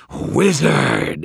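high, loud monster howl; triumphant howl. A narrator's reading. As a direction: howl like a demon breaking free. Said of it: "it howled in triumph".

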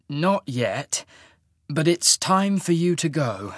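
slow, measured, emotion held in; slow, holding back emotion. A narrator's reading. Half-mage sending his lover away, slow and contained. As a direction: take it slowly, keep feeling out of it. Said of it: "trying to keep the emotion from his voice".

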